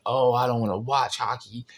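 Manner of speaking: sulky heckler whining; whiny complaining guy. Retold or imitated. Whiny heckler mocked in story.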